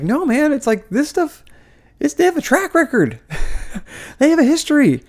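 high-pitched, exasperated insistence; frustrated, voice riding high; real frustration. Real, not acted. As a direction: push back in exasperation, pitch jumping up. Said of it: "this is why I get frustrated".

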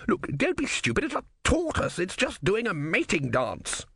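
high, scornful, slowing on the last line; high-pitched know-it-all; scoffing philosopher. Acted. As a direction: dismiss the tortoise with contempt.

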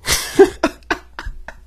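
a sudden squealing laugh, way up high; high burst of laughter. Real, not acted. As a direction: break into a loud, high cackle at the memory. Laughing at his own story.

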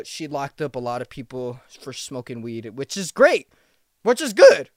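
ordinary delivery

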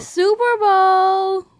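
slow, drawn-out, loud and high; teasing overblown echo; mock grandeur. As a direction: stretch it out like a booming announcer. Mocking echo of big claim.

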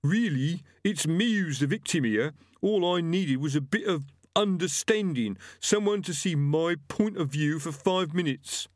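high-pitched, put-on aggrieved killer; self-pitying butcher. Killer claiming to be the victim before Death, self-pitying. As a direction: whine your case high, as the victim. Gripper's held voice.

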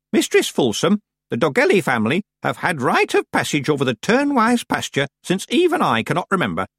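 put-on high-pitched imperious old woman; commanding old witch. Miss Treason lecturing villagers from her hole, imperious. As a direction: scold the villagers in a high, strident voice.

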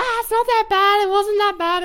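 high breezy mock relief. Patient chirping to friends after a shot, high breezy relief. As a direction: chirp it like it was nothing. Faint Zoe after injection, retold.

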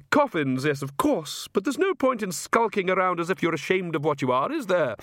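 high-pitched aristocratic lady, airily amused; haughty vampire countess. Acted. Countess musing to guests on spilt blood, airily haughty. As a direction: lilt it high like a grand countess.

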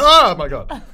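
loud high shocked cry; shocked shout. Retold or imitated. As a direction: yelp it out in shock. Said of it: "she goes like".

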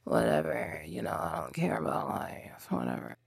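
put-on sulky teen who can't be bothered; a teenager role done flat and muted; bored teenager. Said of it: "a teenager role".

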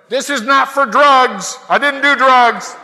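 loud, high mock-desperate shout; hammed-up public protest at full volume; shouting out window. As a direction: holler your innocence to passing cars. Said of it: "you feel like shouting out the window".

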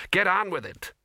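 high-pitched, sharper command; impatient order. A narrator's reading. Ginger to the flaming wizards, impatient high order. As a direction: hurry them along.

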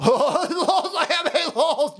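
slow, laughing mock voice; laughing mockery. Mocking the Dark Brandon post, laughing. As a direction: drag it out through laughter.

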